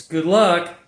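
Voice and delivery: loud, curt Eastern Bloc thug taunt; mock villain. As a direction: toss it off like a smug kidnapper. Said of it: "the Eastern Bloc guy goes".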